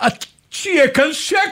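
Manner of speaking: very high, far-off teacher shout, rising at the end. Acted. Teacher shouting at a noisy class for silence. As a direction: yell at the class like a fed-up teacher. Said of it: "המורים".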